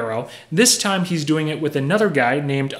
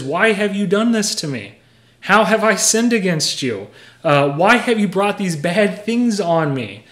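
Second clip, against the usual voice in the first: aggrieved confrontation; 6 semitones higher, 4 dB louder than usual. Retold or imitated. Acting Abimelech confronting Abraham.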